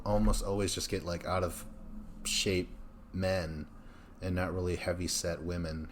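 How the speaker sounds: ordinary talk, nothing marked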